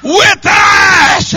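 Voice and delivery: loud, high shouted sermon cadence; hollering revival preaching; full-force preaching. Acted. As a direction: drive each phrase home to the congregation.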